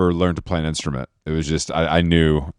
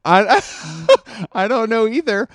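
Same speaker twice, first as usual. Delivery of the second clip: high laughing reply, cracking up; slower than usual. Real, not acted. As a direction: laugh while saying it, pitched up. Laughing at Hinge story.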